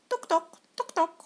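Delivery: high, light knocking at the glass; tapping sound. A narrator's reading. Narrator tapping out a bird's beak on the window glass.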